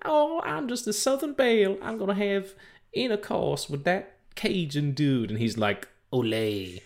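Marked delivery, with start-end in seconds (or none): 0.1-5.3 very high-pitched Southern Belle impression; Southern Belle accent. Mimicking how she talks in the cartoon, high Southern Belle accent. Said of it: "the Southern Belle accent". 6.1-6.8 a botched go at Cajun; failed Cajun accent. Acted. Imitating the cartoon Cajun dude, badly faked Cajun accent. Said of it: "That's not Cajun".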